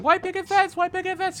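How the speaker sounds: very high, singsong squeak; high squeaky playful rabbit; bouncy annoying little rabbit. Acted. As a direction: chirp it while bouncing around.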